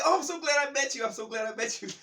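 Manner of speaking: high, loud gratitude; high, loud grateful delight; overjoyed. Retold or imitated. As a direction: beam it out over the shoulder. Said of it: "She was like".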